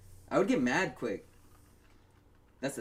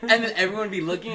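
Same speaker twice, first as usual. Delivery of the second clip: usual speaking voice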